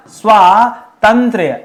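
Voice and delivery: स्वातंत्र drawn out slowly, long आ after स्व; slow model word. Shown as a demonstration. Teacher showing students how to say स्वातंत्र, slowly. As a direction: stretch स्वातंत्र so the long आ is clear. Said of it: "ऐसे करिएगा".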